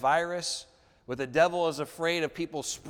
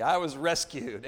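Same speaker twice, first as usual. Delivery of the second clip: ordinary talk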